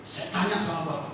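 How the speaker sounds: normal talking voice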